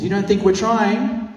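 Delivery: mother scoffing at activist, high and laughing; indignant mockery. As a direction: scoff at him as if it were easy. Indignant wife quoted, laughing.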